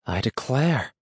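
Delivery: lightly voiced country woman, taken aback; muttering woman. A narrator's reading. Large woman on seeing the captured boy, mildly muttered surprise. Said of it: "she muttered".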